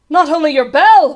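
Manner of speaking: loud, high, urgent voice; alarmed cry. A narrator's reading. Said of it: "Bartholomew cried".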